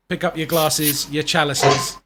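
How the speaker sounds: slow, drawn-out toast; show sign-off toast. Acted. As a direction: raise a glass and send them off.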